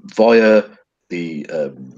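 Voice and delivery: normal talking voice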